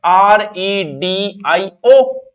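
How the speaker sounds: letters spelled out slowly; slow spelling. Shown as a demonstration. Teacher spells radio for students, slowly. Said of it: "spelling it out".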